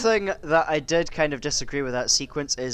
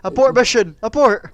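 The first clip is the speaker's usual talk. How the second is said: mock alarm call; 8 semitones higher, 8 dB louder than usual.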